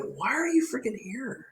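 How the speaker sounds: high, hushed incredulous question; retold exasperation. Retold exasperation at listless vendor.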